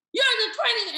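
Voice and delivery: high, loud cutting complaint; angry, frustrated seller. Acted. Coach playing seller complaining to twentieth agent, angry. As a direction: imitate an agitated seller yelling. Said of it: "angry, frustrated".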